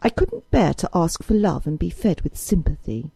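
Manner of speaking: ordinary delivery, nothing marked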